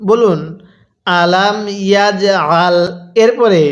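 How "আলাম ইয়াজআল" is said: slow "আলাম ইয়াজআল", bounced "j"; model recitation. Shown as a demonstration. Teacher has learners read along, slow measured "আলাম ইয়াজআল". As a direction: draw out "আলাম ইয়াজআল" syllable by syllable. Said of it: "আরেকবার আমার সাথে পড়ুন".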